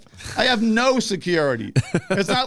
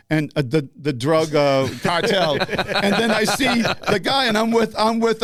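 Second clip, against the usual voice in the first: ordinary talk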